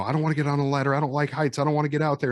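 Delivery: whiny pretend panic on the ladder; mock scared. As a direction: play the frightened victim, hammed-up. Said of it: "pretend like I'm scared".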